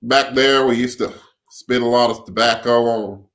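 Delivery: put-on Texan accent; country accent. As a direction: attempt an amateur Southern country accent. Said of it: "give me your best country accent".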